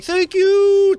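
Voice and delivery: squeaky high thank you; high grateful gush. Retold or imitated. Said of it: "they immediately are like".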